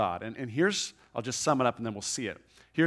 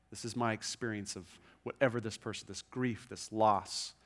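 ordinary talk, nothing marked

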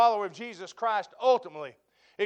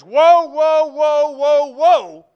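much louder, higher woes shouted; thundering woes; 5 semitones higher, 13 dB louder, much slower than usual. Acted. Preacher shouting God's woes at the sinner. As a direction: hurl each woe down like doom. Preacher voicing God at climax.

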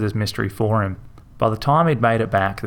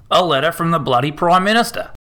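scoffing; 7 semitones higher than usual. A narrator's reading. Harold scoffs at the letter.

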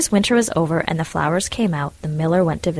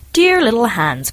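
slow, pleading call; crying out; 5 semitones higher, 5 dB louder than usual. Acted. Miller at the door at night, calling out to Hans with urgency. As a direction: call to him with mock urgency. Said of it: "cried the miller".

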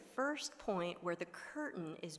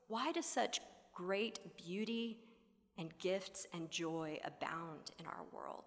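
hushed whisper; 6 dB quieter than usual. Acted. Continuing the hushed question.

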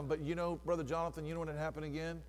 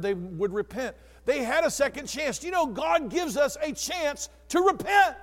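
loud, higher, impassioned preaching to the congregation; full-force preaching; 5 semitones higher, 10 dB louder than usual. Acted. Pastor rousing his congregation about God's deliverance, full-force preaching. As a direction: preach it out, building fervour.

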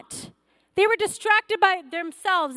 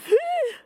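sudden high intake of breath; a startled little gasp; caught-by-God gasp; sharp gasp; 12 semitones higher than usual. Retold or imitated. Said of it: "you're like".

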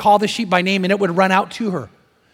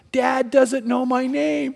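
slow, higher mock wail; imitated child crying; 4 semitones higher, much slower than usual. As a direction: whine it out like a tearful little boy. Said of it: "and he's crying".

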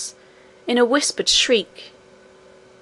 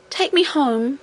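higher-pitched, mildly upset plea; crying out; 4 semitones higher than usual. A narrator's reading. Princess begs her nurse, crying out. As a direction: plead like a child who wants to leave. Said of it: "cried the princess".

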